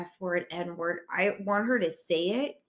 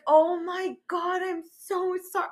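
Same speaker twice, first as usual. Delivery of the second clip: mortified apology; 10 semitones higher than usual. Retold or imitated. Apologizing to the stepmom for cursing at her, mortified. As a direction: apologize in horrified embarrassment, pitched way up. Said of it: "I was so mortified".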